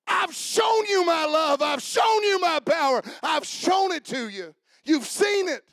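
loud, high, driving sermon delivery; full-force preaching. Acted. Pastor exhorting the church, preaching at full force. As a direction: preach it out over the congregation.

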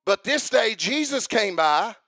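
high, driving revival-preacher shout; full-force preaching. Real, not acted. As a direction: build to a ringing, impassioned climax.

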